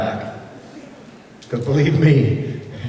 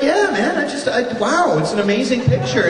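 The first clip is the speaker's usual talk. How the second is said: high, flustered praise of the drawing; flustered awe; 9 semitones higher than usual. Retold or imitated. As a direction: gush nervously at the artist. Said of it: "I'm just like".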